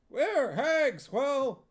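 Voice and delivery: high, loud cackly chorus; witches' chorus. Acted. Witches answering Huel in a high loud chorus. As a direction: shout back like eager hags.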